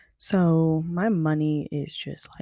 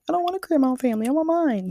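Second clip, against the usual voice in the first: high-pitched, rushed, louder whine; whiny protest; 10 semitones higher, 5 dB louder, faster than usual. Retold or imitated. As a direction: protest like a kid refusing to budge. Acts out protesting to her uncle.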